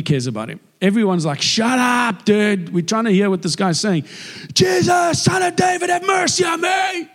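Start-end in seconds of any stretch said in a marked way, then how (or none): 1.4-4.0 higher-pitched irritated shushing, acted out; acted annoyed crowd. Crowd silences the blind man. 4.5-7.1 very high, drawn-out desperate shout; imitated screaming. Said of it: "And he's screaming".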